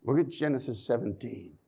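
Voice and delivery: usual speaking voice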